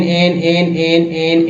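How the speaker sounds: एन said slowly and clearly, one sound each time; drilled sound. Shown as a demonstration. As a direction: repeat एन carefully like a teacher drilling. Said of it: "ईएन को एन". (एन को साफ़-साफ़ दोहराते हुए)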